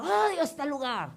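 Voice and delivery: high, angry retold yell; angry shouting. Getting out of the damaged car, furious high yell. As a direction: shout your hatred of the place, pitch up. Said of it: "gritando y diciendo".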